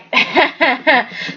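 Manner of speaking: a short amused laugh; a real amused chuckle. Real, not acted. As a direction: laugh it off lightly. Laughing at own remark.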